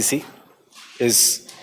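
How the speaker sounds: slow note dictation with long pauses; classroom dictation. Real, not acted. Teacher gives students a line for prelims, slow dictation.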